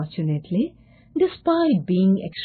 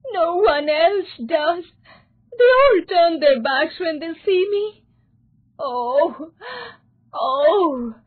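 high-pitched, tearful begging; acted distressed wail; alarmed pleading; 10 semitones higher, 4 dB louder than usual. A narrator's reading. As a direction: plead desperately, close to tears. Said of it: "he asked in alarm".

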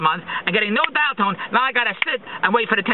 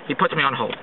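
ordinary talk, nothing marked

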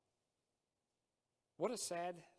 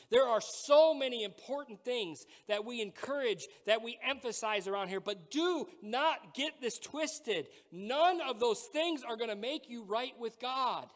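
louder, higher preaching, driving each point home; full-force preaching; 6 semitones higher, 6 dB louder than usual. Acted. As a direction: pastor pleading with the church about salvation, at full force.

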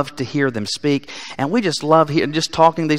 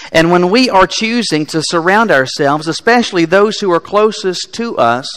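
higher, louder, urgent sermon delivery; full-force preaching; 5 semitones higher, 8 dB louder than usual. Acted. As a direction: drive the warning home from the pulpit. Preaching climax on the easy road.

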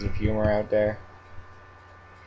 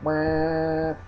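imitating the dryer; 6 semitones higher than usual. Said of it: "My dryer just went off. It's annoying. It's like".

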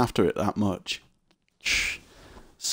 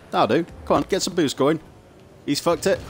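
normal talking voice

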